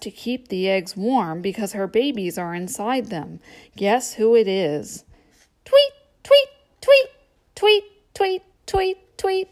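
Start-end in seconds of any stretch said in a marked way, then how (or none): 5.7-9.5 very high chirping tweets; bird tweet. Acted. Bird sound in guessing book.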